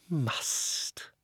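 breathy, sinister repeat of "Must"; menacing echo. Acted. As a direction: hiss the word back at him. Uncle echoes child's word.

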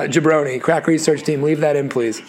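normal talking voice

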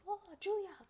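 high, swooning call; playful sing-song. Pretend. Vlogger suddenly calling out the name, playful high croon. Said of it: "I don't know why I'm like".

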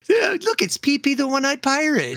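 high, cooing, mocking; mock baby talk. Mocking a man over his tiny gun, high cooing. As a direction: coo at it like a baby.

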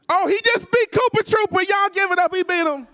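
high, loud victory shout; cheering. Retold or imitated. Kids on the bed cheering their friend's win, shouting. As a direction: erupt like your team just won the Super Bowl.

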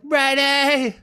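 high calling shout; mock yelling. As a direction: yell his name across the sea. Yelling for friend lost overboard.

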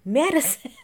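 hammed-up shout of Madison! through a laugh; mock catchphrase yell breaking into laughter; imitating Danny Pellegrino. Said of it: "Danny Pellegrino saying it".

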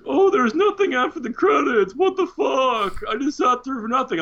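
high, loud mock whine with a laugh; hammed-up complaining audience; mocking whiny moviegoers. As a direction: whine like a cheated fan. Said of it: "these fucking people in the theater were all like".

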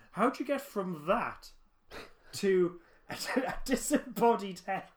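loud, high, incredulous question; laughing incredulity. Real, not acted. Podcaster baffled by the disembodied head tribute, laughing incredulously. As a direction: ask in disbelief, breaking into laughter.

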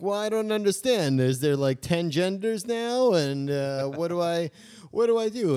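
high, loud, exasperated dad voice; mock confused father; baffled dad. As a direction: whine it like a lost old-school dad. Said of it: "where they're like".

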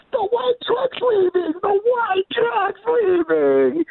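high, slow, drawn-out mock wail; calling out alarm. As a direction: holler the lookout's warning, stretching every word. Acts out lookouts calling out.

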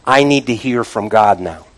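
low, soft, resolute plea; quiet resolve. Acted. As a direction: drop low and soft, set on seeking God. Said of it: "This is what David's saying".

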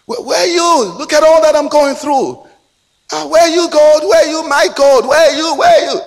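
very high, loud calling out to God; insistent high-pitched pleading shout; acted desperate cry. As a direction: imitate a man wailing his complaint upward. Acts out man crying to God.

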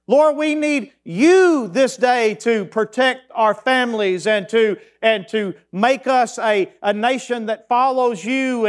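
slow, drawn-out pleading prayer, pitched high; fervent prayer. Acted. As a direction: plead with God, stretching every line. Pastor voices a pleading prayer.

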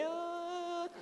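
high, breathy little whine; daughter's squeal. Retold or imitated. Daughter squeezed in a hug, a high little whine. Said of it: "ela só geme".

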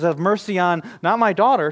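loud high plea; pleading. Retold or imitated. Mother pleading with Jesus, loud high plea. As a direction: plead for help.

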